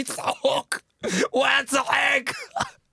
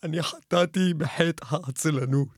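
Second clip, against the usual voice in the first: solemn confession; 7 semitones lower than usual. Acted. Caller confessing his sin to the radio host, low and solemn.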